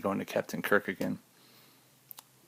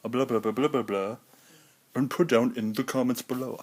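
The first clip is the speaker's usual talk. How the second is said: slightly higher mock YouTuber patter; mock YouTube voice; 3 semitones higher than usual. Shown as a demonstration. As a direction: rattle it off with no pause. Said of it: "No pause".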